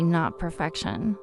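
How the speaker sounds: ordinary delivery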